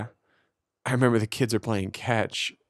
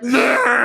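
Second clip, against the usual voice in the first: loud, high cry; hammed-up burst of upset; mock wail; 13 semitones higher, 7 dB louder than usual. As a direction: blurt out an exaggerated high wail. Imitated reaction to kid's blunt question.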